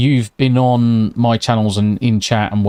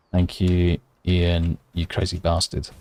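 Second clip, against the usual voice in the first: softer, lower thanks so the family stays asleep; hushed thanks; 5 semitones lower, 5 dB quieter than usual. Real, not acted. Streamer thanking the donor quietly at night, hushed. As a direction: keep it down, fondly. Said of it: "have to do it slightly quietly".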